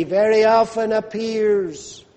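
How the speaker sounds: usual speaking voice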